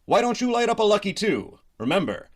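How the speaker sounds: usual speaking voice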